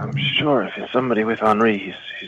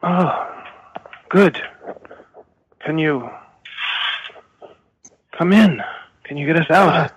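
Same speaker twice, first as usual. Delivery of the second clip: eager prisoner; 7 semitones higher, slower than usual. Acted. Prisoner player pleads in character.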